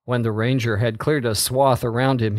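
usual speaking voice

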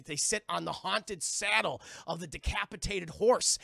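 ordinary talk

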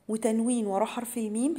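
ordinary talk, nothing marked

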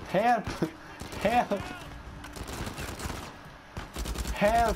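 high hammy calls for help; half-joking yell for help; mock cry for help. Overwhelmed in a fight.

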